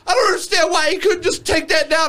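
high, whiny mock complaint; whiny fan voice. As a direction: whine like a sore fan. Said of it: "he goes".

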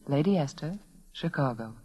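usual speaking voice

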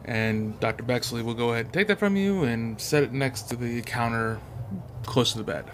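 ordinary delivery

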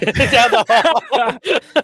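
slow teasing repeat; mocking drawn-out echo. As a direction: stretch it out mockingly. Mocking the quote slowly.

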